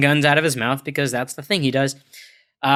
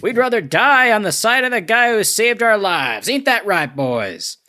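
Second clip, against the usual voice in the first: high-pitched, hammed-up rallying cry; put-on high heroic voice; defiant underlings; 7 semitones higher, 3 dB louder than usual. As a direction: act out a henchman rousing his brothers. Acting out Pleasures rallying their comrades.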